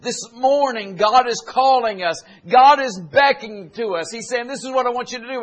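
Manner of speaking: full-force preaching. Acted. Pastor exhorting the church, building and loud. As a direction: drive the message home to the congregation.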